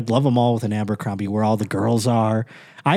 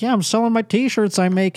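much higher mock vendor voice; imitated craft seller; 9 semitones higher than usual. Woman selling T-shirts in the mall, imitated. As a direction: lightly send up a homemade-goods seller.

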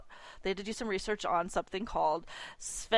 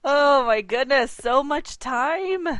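excited; 7 semitones higher, 8 dB louder, much slower than usual. Real, not acted. Exclaiming at long gap.

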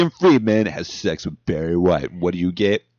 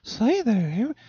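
high falsetto try; 12 semitones higher, 5 dB quieter than usual. Shown as a demonstration. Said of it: "Trying to find the range".

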